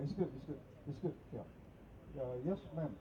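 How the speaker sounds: usual speaking voice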